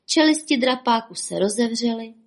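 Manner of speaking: plain reading voice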